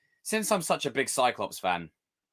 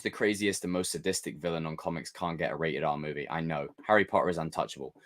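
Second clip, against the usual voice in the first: ordinary talk, nothing marked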